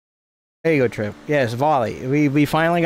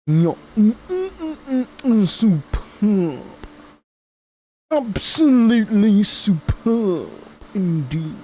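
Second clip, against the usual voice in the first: high, slow savouring gourmet voice; posh connoisseur; 8 semitones higher, slower than usual. Acted. Streamer hamming up praise for chat, slow posh relish. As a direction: relish each word like a snob tasting wine. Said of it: "Sounds like you got Botox done".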